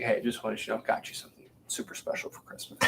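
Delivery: hushed, conspiratorial whisper; soft whispered husband; whispered secret. Retold or imitated. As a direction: whisper it like a surprise. Husband in SNL spoof.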